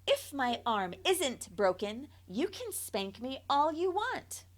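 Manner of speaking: put-on child bribing her mother; bargaining. A narrator's reading. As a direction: tempt her with a deal. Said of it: "I bribed".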